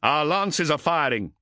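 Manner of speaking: stern voice over the vox; a captain giving orders; commanding captain voice. Acted.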